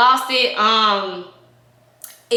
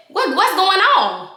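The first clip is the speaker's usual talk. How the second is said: confronting demand; 8 semitones higher, slower than usual. Retold or imitated. Confronting him in front of girl.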